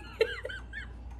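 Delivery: a very high, breathy burst of laughter; squealing laugh. Real, not acted. Telling coworker her dad died, a squealing laugh. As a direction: let a squeal of laughter escape.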